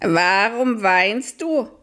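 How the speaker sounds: high, slow, hooting owl. Acted. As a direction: stretch it out like an owl calling in the night. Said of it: "fragte die Stimme". (hohe, langsame Eulenstimme)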